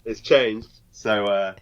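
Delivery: ordinary talk, nothing marked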